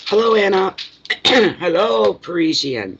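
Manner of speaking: high, loud singsong hello; cheery greeting. Real, not acted. Reader greeting her client again, high and loud. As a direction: welcome her brightly.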